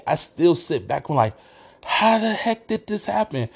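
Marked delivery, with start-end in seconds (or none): 1.8-3.5 high-pitched, astonished disbelief; retold amazement. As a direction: recall being stunned, pitch shooting up. Retelling his amazement at the game.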